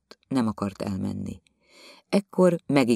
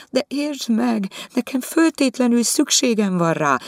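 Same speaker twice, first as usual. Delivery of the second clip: pleading wife; 13 semitones higher, 4 dB louder than usual. Acted. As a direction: beg him to understand. Viziné pleads. (könyörgő feleség magasabb hangon)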